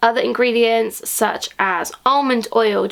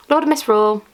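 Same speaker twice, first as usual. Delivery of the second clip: normal talking voice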